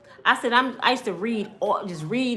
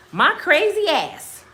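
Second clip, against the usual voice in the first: ordinary delivery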